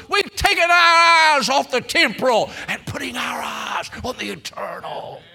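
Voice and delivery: high, loud preaching cry, easing at the end; shouted exultant answer. Acted. Singers answering in the urologist's office, shouted proclamation. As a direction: proclaim it, then drop on the last words.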